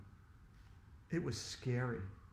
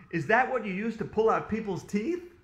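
higher, louder, horrified asking; alarmed question; 6 semitones higher, 6 dB louder than usual. A narrator's reading. Boy asks dentist about the pliers, alarmed. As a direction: ask in alarm, voice raised.

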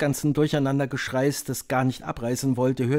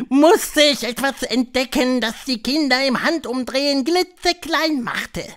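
high, loud witch voice; high-pitched, theatrical witch leader; Grand High Witch; 12 semitones higher, 6 dB louder than usual. A narrator's reading. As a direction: lecture the coven in a high, imperious voice.

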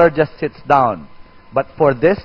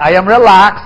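said out louder in full voice; 4 dB louder than usual. Shown as a demonstration. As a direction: project it to the room.

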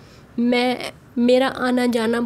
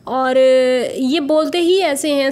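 slow and louder, showing their pace; slow demonstration; 5 dB louder than usual. Shown as a demonstration. Vlogger explains to viewers how they talk, slow demonstration. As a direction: stretch it out to show how slowly they talk. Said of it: "slow slow इसी तरह बोलते हैं".

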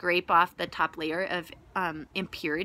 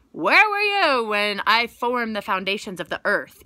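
booming voice of God; 8 dB louder than usual. Retold or imitated. God answering Job, retold.

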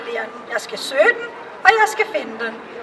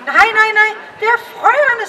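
put-on higher, louder quick little girl's denial; kitchen girl correcting; 6 semitones higher, 4 dB louder than usual. The kitchen girl corrects the courtiers about the cows, higher and louder. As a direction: shake your head at the silly courtiers.

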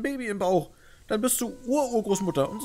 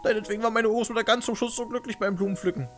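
tearful; 11 semitones higher, 3 dB louder than usual. Acted. Kasche in tears.

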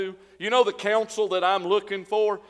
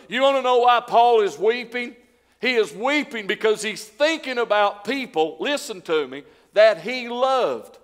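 louder, rising appeal from the pulpit; fervent preaching; 6 semitones higher, 5 dB louder than usual. Acted. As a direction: plead with the congregation about Paul's tears. Preaching at full force.

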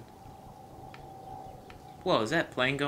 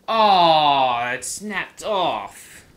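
loud, drawn-out dismay; 4 semitones higher, 11 dB louder, much slower than usual. Real, not acted. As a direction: moan it as the line snaps. Line snaps, loud dismay.

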